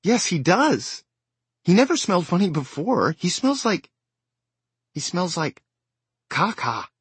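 put-on high, loud child voice; a worried little girl; distressed child.

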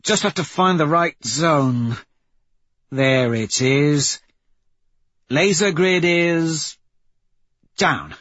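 high voice, stretching words while working; Pebble, hacker at work. Acted. Pebble shutting off security from the datapad, drawn-out reporting. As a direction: draw out each status until it completes.

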